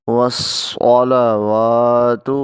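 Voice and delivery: والصلوات with a hissing, heavy saad, slow; whistled saad. Shown as a demonstration. Teacher showing the saad's whistle, slow. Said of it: "صاد کے اندر سٹی کی آواز آئے گی".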